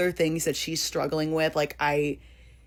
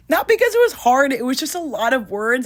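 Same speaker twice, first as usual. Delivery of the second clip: emphatic protest; 9 semitones higher, 7 dB louder than usual. Real, not acted. Stressed, insisting it wasn't hard.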